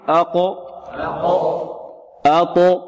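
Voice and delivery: qaf and ta echoed in "أقو، أطو" with a neutral bounce, no vowel; qalqalah bounce. Shown as a demonstration. Teacher has students repeat after him, qalqalah echo on "أقو، أطو". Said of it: "هكذا القلقلة لا تميل".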